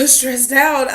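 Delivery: laughing through the words stressed out. Real, not acted. Telling viewers how stressed she was, laughing.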